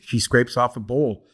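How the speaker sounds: plain reading voice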